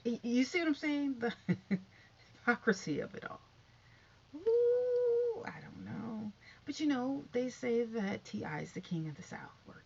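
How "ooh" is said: high, loud, held "oooh"; long "ooh". Real, not acted. Vlogger reacting to the hypocrisy, high drawn-out hoot. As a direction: sustain a high hooting "ooh".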